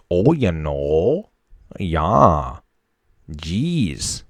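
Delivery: put-on slow Wisconsin accent, stretched vowels; drawn-out Wisconsin "Oh, geez". Showing how he used to sound, slow Wisconsin accent. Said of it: "that's what I sounded like".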